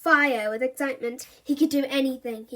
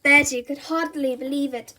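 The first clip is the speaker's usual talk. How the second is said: plain reading voice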